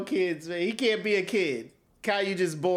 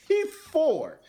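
put-on high whiny four-year-old voice, mid-laugh; whiny kid voice; 10 semitones higher than usual. Friends mocking Caillou, whiny high kid voice. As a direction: play the upset little boy, pitched way up.

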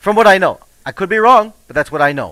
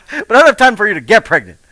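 laughing through it, higher and louder; 6 semitones higher, 5 dB louder than usual. Real, not acted. Speaker laughing at his old panic, higher and louder. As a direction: chuckle at your own line.

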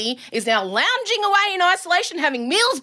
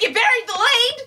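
exasperated retort; 10 semitones higher, 3 dB louder than usual. Retold or imitated. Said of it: "exasperated retort to husband".

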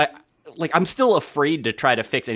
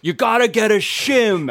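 loud, high, slow holler from another room; dad in the background; 9 semitones higher, 5 dB louder, slower than usual. Retold or imitated. Son imitating his dad butting into the call, hollering. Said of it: "my dad in the background being like".

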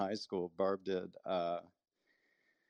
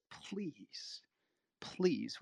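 whispered plea; 5 semitones higher, 5 dB quieter than usual. Retold or imitated. Begging the GM again.

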